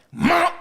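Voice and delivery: high, loud impact noise; imitated smack. Narrating a smack to the ground, loud imitated impact. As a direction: pop it out as a sudden blow.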